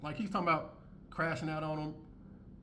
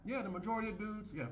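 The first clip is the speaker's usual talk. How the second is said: retold aside; 7 semitones higher, 4 dB quieter than usual. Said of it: "I was like".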